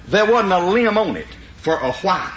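ordinary talk

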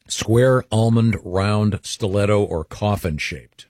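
slowed down, each option spaced out; slow, deliberate repeat. Shown as a demonstration. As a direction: read the list again slowly for clarity. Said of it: "What are they again?".

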